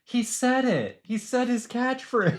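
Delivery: high-pitched gushing fan voice; mock starstruck fan. Fan hears the catchphrase, mock starstruck. Imitates delighted fans hearing it.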